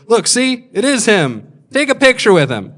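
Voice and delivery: high, loud, eager urging; excited dad. Retold or imitated. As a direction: push the kid excitedly toward a photo. Dad eagerly shows kid celebrity.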